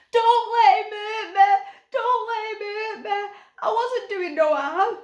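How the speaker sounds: very high, loud pleading wail; pitiful wail. A narrator's reading. Captive Snaggart begs Barney to save him from Stig, pitiful wail.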